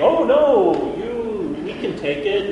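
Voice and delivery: high, bold encouragement; rally the crowd; a touch of brave leader; confident Moses. Retold or imitated. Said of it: "Moses standing up and saying".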